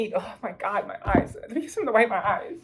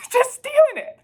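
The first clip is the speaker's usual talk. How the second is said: laughing high through the words; 4 dB louder than usual. Real, not acted. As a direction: chuckle it out, amused at the theft. Laughing mockery about stolen dish.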